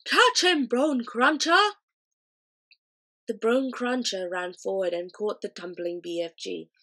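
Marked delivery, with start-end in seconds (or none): 0.1-1.7 giant shouting. A narrator's reading. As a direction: fling the call across the field. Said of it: "shouting him".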